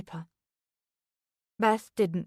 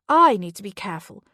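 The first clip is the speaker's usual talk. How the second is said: louder, bitter mocking repeat; sarcastic echo; 8 dB louder, faster than usual. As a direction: throw the words back scornfully. Mocking repeat of Anna's advice.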